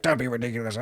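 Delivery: quiet, grumbled brush-off; grumpy dismissal. Acted. Old duck refusing the elf's boost, grumpy. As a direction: grumble it off under your voice.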